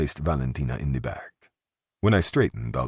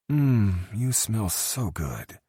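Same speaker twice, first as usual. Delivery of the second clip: drowsy tipsy purr; 7 semitones higher, much slower than usual. A narrator's reading. Drunk Valentina nuzzles Luca.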